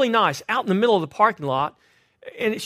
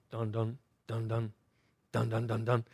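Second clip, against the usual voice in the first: dun dun dun; 10 semitones lower, 11 dB quieter than usual. Retold or imitated. Ominous music for stalking ladies.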